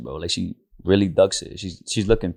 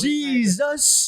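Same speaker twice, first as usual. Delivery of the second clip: hype announcer. Acted. Hyping the show name.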